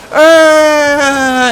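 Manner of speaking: high, loud, long-held mock scream; slow-motion scream. Fan mocking stabbed Frodo in the movie, slow-motion scream. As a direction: melodramatic drawn-out cry of pain. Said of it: "in slow motion".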